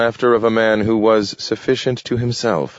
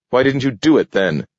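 higher, sharp snapped question; 6 semitones higher, 3 dB louder, faster than usual. A narrator's reading. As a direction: bite the question off at him. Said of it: "snapped Webster".

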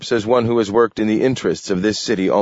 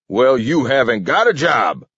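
higher-pitched angry roar, held in; 7 semitones higher, 4 dB louder than usual. A narrator's reading. King sacking Webster, roaring. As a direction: fire him furiously. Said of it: "roared King".

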